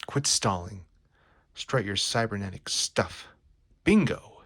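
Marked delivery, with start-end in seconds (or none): 3.8-4.4 a sudden high, loud cry of triumph; triumphant bingo. Acted. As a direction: pounce on the word like a winner. Robot locates position at last.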